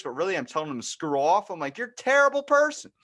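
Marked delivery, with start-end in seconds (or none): 1.8-2.9 high, loud mock indignation; indignant scolding. Learner to the friend who tricked him, indignant scolding. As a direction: scold loudly in exaggerated outrage.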